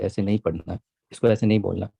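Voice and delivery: ordinary delivery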